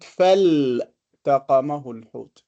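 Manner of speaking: "فالتقمه الحوت" with a clear L in fal-taqamahu; pronounced lam. Shown as a demonstration. Teacher contrasting this one, lam clearly sounded in "فالتقمه الحوت". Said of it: "we pronounce it".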